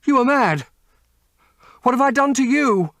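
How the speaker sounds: high, loud panicked protest; terrified Dorian. A narrator's reading. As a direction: cry out, cornered and terrified.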